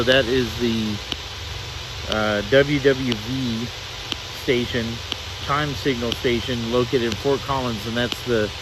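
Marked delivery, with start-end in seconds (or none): none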